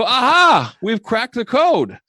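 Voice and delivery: high, loud triumphant cry, then slowed; excited breakthrough. Retold or imitated. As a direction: shout it like you just solved the puzzle. Coach retells breakthrough moment.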